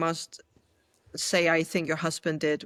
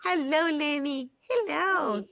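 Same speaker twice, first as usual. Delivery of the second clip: pet voice; 9 semitones higher than usual. Real, not acted. Greeting the dog.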